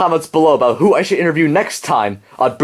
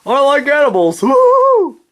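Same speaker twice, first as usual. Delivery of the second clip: mock furry voice; 9 semitones higher than usual. [name] mocking how furries talk, much higher squeal. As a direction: squeal the woo-hoo way up high. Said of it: "furries can talk like this".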